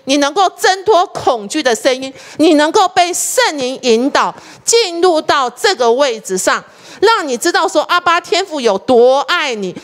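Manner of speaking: slow, emphatic preacher's delivery; full-force preaching. Acted. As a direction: preach it out slowly, word by word. Preaching part of sermon. (放慢语速的激昂讲道)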